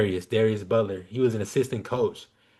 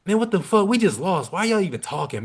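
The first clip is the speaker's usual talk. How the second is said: angry coach yelling; 8 semitones higher than usual. Retold or imitated. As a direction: bark at the players like a fed-up coach. Angry head coach yells at players.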